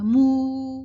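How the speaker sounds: مو held very long as a loud "mooo"; tight rounded vowel. Shown as a demonstration. Teacher giving a madd example, firm rounded vowel. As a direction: round the lips fully and sustain مو. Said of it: "ہونٹوں کو بالکل گول کر کے".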